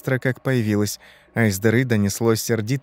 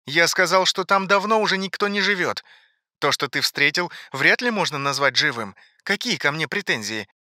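higher voice from a television; defensive TV announcer; 6 semitones higher than usual. Acted. TV announcer rejects Ivan's complaint, defensive. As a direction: argue back, shrugging off blame.

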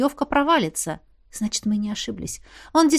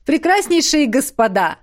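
loud, higher call to the crowd; stage announcer; 5 semitones higher, 5 dB louder than usual. A narrator's reading. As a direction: open the show grandly. Host addresses crowd from stage. (громко, как ведущий со сцены)

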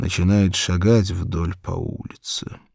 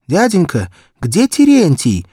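put-on high, loud child's voice, anxious; a frightened little girl begging for help; little girl pleading; 10 semitones higher, 8 dB louder than usual. Barefoot girl asks every passer-by, high pleading child voice.

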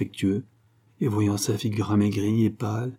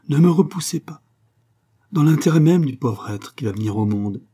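pleading; 5 semitones higher, 5 dB louder than usual. Acted. Joseph pleads with Angèle.

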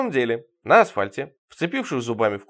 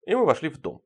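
ordinary delivery

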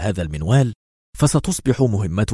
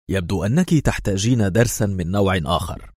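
usual speaking voice

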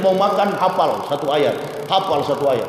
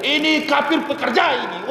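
imitated yell; 8 semitones higher than usual. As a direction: imitate an indignant shout with raised pitch. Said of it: "Langsung dia teriak".

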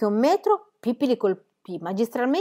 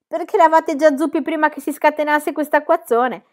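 high, loud taunt; teasing call; 7 semitones higher, 7 dB louder than usual. Acted. Pippi answering soaked men below, loud teasing. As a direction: call the joke down to them.

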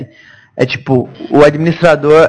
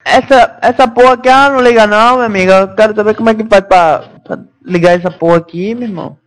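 rude customer voice; 4 semitones higher than usual. Pretend. Playing rude clueless customer.

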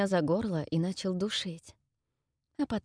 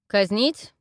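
threatening question; 3 semitones higher, 7 dB louder than usual. Acted. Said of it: "И вот что мне теперь".

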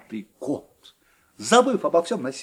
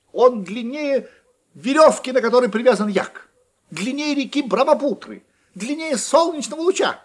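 mounting boast; 8 semitones higher, 4 dB louder than usual. A narrator's reading. Mouse bragging to her friend, voice climbing higher.

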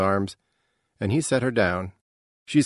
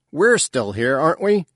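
louder, sharply rising challenge; angry father; 6 semitones higher, 5 dB louder than usual. A narrator's reading. Father angry at being left behind, louder challenge. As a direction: throw the question out, raised voice. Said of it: "the crack of his voice".

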